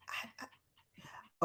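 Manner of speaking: breathless aside, barely voiced; stunned whisper. Real, not acted. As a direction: whisper it in disbelief. Stunned after shocking comment.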